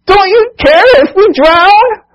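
high, loud, slow wailing plea; desperate blaming cry. Acted. Frightened disciples accusing sleeping Jesus, high desperate wail. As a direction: cry out in terror and accuse him. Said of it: "it's like they're blaming him".

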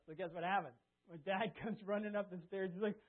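ordinary talk

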